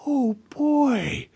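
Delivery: airy, misplaced wonder; dreamy mock amazement; unfitting protagonist voice. Host mimics amnesiac hero recalling everything, dreamy wonder. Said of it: "he's going around like".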